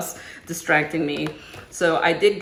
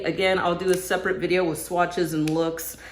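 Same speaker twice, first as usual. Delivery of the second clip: ordinary delivery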